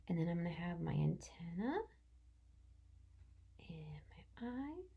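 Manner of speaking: soft whisper while drawing. Real, not acted. Teacher whispering to herself while drawing. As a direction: breathe the words out as you draw.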